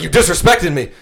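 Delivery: hammed-up drunk grievance; drunk accusation. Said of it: "she's like".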